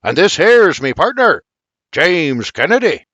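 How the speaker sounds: loud, high Irish introduction; bellowed in a hearty Irish accent; billowing. Acted. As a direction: boom it out across the table.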